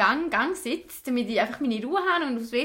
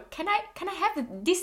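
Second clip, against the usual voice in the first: put-on German-English accent; 7 semitones higher, 4 dB quieter than usual. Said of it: "auf einem krassen hochdeutschen englischen Akzent".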